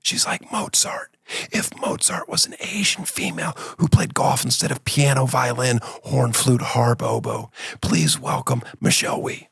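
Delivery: hushed golf announcer whisper. Pretend. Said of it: "the golf whisper voice".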